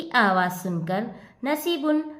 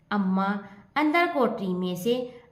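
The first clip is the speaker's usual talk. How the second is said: usual speaking voice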